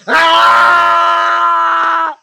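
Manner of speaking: high furious monster roar; enraged boss roar. Acted. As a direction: bellow it out in rage. Jinn roars under the healer's spell.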